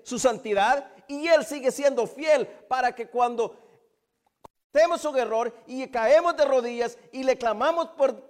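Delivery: loud, high, driving sermon voice; fervent preaching. Real, not acted. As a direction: pour out the list at full force. Preaching at full force.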